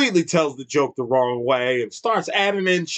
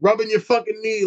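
ordinary delivery

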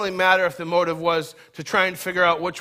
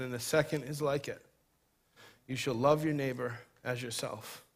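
hushed preaching; 5 semitones lower, 10 dB quieter than usual. Acted. Quiet reflective preaching stretch.

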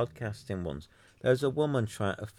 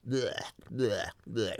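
mock retching; slower than usual. Real, not acted. Reacting to fake flattery on Clubhouse, mock retching noises. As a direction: fake gagging blech sounds. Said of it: "It's vomit".

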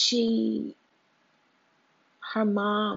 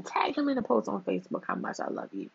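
usual speaking voice

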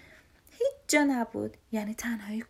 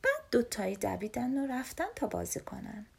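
plain reading voice

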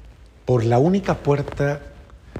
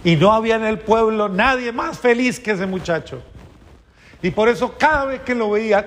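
high, loud, impassioned sermon storytelling; fervent storytelling preaching; 11 semitones higher, 4 dB louder than usual. Acted. Priest telling the congregation Saint Clare's story, loud and fervent. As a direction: carry the congregation with rising fervour.

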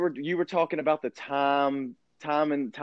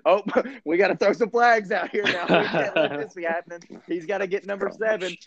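laughing mock referee voice, pitched higher; mocking the refs; 3 semitones higher than usual. Mocking refs who throw flags for Tom, laughing mock voice. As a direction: sneer it like a ref handing Tom the game. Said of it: "when Tom throws an interception".

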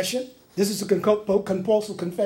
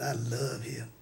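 low, hushed whisper in the ear; whispered manipulative flattery; sneaky whisper; 9 semitones lower, 11 dB quieter than usual. Acted. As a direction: murmur it slyly, very soft and low. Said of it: "start whispering in David's ear".

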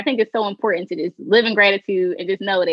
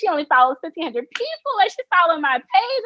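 fangirl excitement; 8 semitones higher than usual. Retold or imitated. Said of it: "I'm like".

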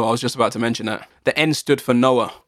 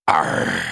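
low gruff pirate "Arr"; pirate growl. Pretend.

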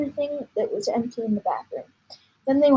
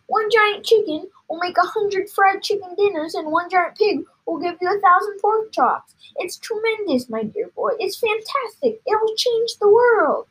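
high-pitched rapture; carried-away father gushing; excited cry; 9 semitones higher, 3 dB louder than usual. A narrator's reading. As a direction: rattle off the wonders, rising to a big finish. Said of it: "cried Mister Cranky, waving his arms".

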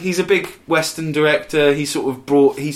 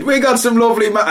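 high fast mock Irish; 10 semitones higher, 4 dB louder, faster than usual. Mock Irish chart host, fast high Irish. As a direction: rattle it off in a hammed-up Irish lilt. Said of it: "In Ireland, they said".

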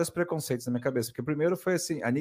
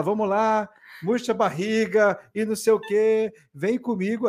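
higher, peppy mock instructor; 7 semitones higher than usual. Mocking the cycling instructor hyping the class, higher. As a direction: pump up the class like a spin coach. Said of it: "E aí ele fala".

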